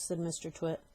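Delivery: plain reading voice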